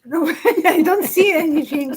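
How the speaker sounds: amused, laughing repeat. Real, not acted. Repeats the line while laughing.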